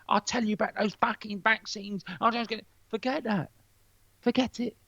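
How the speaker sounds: a hint of a ranting know-it-all; mock ranting neighbour. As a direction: lampoon the neighbour preaching about vaccines. Mocking the vaccine ranter next door.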